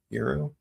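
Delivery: "Euro" said YEER-oh, rising; alternate pronunciation. Shown as a demonstration. Comparing ways to pronounce gyro, "Euro" as YEER-oh. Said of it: "Gyro is really pronounced hero".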